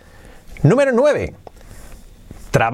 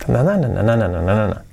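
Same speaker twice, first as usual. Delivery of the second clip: chanting the sentence melody in na-na syllables; nonsense-syllable rhythm demo; intonation on "na"; 6 semitones lower, faster than usual. Shown as a demonstration. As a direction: replace the words with quick "na"s, falling away. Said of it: "Sería así".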